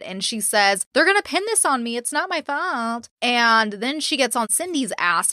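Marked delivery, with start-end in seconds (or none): 0.9-3.1 higher-pitched imitation of a victim act; mock self-pity. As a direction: mimic her poor-me protest, pitched up. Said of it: "poor me".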